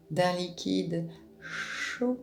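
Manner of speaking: ordinary delivery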